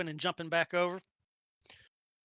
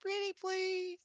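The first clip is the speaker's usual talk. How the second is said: pleading; 10 semitones higher, slower than usual. Acted. Begging with big fox eyes.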